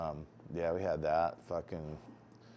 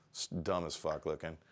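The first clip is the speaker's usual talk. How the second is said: ordinary talk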